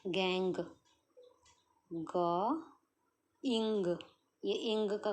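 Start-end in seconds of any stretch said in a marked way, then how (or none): 0.1-3.9 gang split into ग and इंग; teacher splitting a word aloud; sounded out slowly. Shown as a demonstration. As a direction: break गैंग into its sounds, one at a time. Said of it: "बोल बोल के practice करते जानिए".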